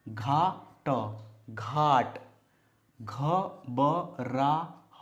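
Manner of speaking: syllables stretched apart; slow sounding out. Shown as a demonstration. Teacher stretching a word out for beginners. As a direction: sound out each syllable slowly. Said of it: "ये बना घ".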